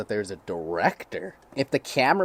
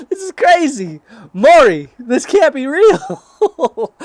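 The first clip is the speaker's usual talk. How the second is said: loud, high shriek through laughter; helplessly cracking up while shouting; real laughing fit, voice pitched way up; hysterical disbelief; 14 dB louder, much slower than usual. Real, not acted. Cracking up at the story's twist.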